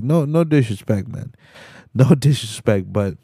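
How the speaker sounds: ordinary talk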